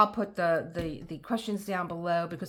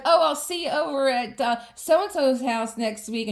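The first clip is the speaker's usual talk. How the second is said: mock cheerful invite; 6 semitones higher, 8 dB louder than usual.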